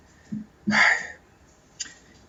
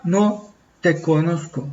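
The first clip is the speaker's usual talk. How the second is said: softer, slow and final; cold dismissal; 2 semitones lower, 7 dB quieter, slower than usual. Acted. The Lord refusing the pleaders, soft and slow. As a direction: let it fall like a closed door.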